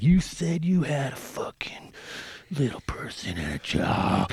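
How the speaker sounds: slow, seething whisper; pissed-off whisper. Retold or imitated. Imagining the angry star's thoughts during the smoke, pissed-off whisper. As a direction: imitate silent fury in a drawn-out whisper. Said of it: "'cause he's pissed".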